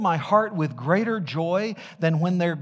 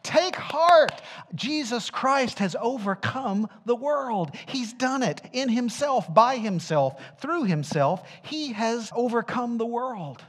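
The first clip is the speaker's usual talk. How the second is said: high, loud pulpit proclamation; preaching at full force; 8 semitones higher, 4 dB louder than usual. Acted. Preacher proclaiming Christ's victory to the congregation, full force. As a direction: ring it out to the whole church.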